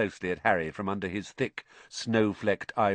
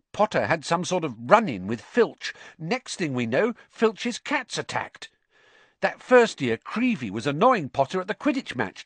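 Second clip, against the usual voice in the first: higher-pitched schoolboy building a case; accusing Ernie; 7 semitones higher than usual. A narrator's reading. Ernie listing attacks to the Hufflepuffs, accusing. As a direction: lay out the evidence like a gossiping pupil.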